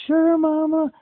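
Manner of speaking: thin, high, strained voice of a man worn out after a hundred-mile ride; drained weak voice. Retold or imitated. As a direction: squeeze the words out as if there is no energy left. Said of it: "Like, I can't talk. I'm weak".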